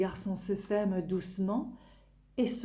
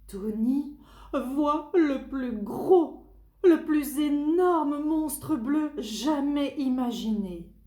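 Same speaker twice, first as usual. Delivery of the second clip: slow, high, loud wonder; dramatic awe; 5 semitones higher, 4 dB louder, slower than usual. A narrator's reading. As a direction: stretch out the huge monster. Big monster reveal.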